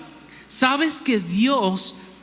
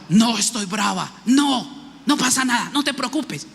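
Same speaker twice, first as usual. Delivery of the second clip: annoyed denial; 3 semitones higher than usual. Pretend. Wife denies being angry.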